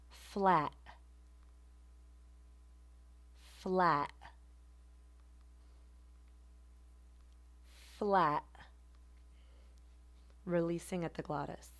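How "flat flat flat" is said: "flat" ending in a glottal catch, t unreleased; glottal stop flat. Shown as a demonstration. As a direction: cut "flat" off in the throat. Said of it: "So instead of flat, it will be".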